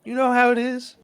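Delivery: high, playful coquettish voice; teasing dancer. Acted. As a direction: coo it like a flirt brushing him off. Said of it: "And Virginia's like".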